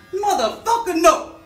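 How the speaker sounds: very high, loud, hammed-up refusal; cartoonish high shout of outrage; mock outraged yell. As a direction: explode in squealing fake indignation. Acted outraged answer to the term.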